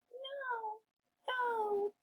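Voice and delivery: tiny pig. Acted. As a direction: squeak it quietly. Pigs refuse.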